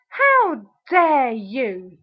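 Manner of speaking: very slow, drawn-out indignation; ranting outrage. Real, not acted. Telling off the ex who called her worthless, outraged. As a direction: drag out every word in anger. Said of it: "I'm all ranted and raved out".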